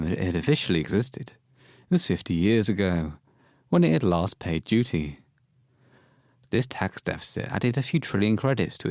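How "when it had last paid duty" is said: ordinary talk, nothing marked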